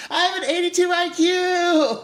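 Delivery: very high, loud mock squeal; stoked. Imitating paid ad girl bragging about IQ result, hammed-up stoked squeal. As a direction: imitate clueless delight at full pitch. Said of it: "she's just stoked about being a moron".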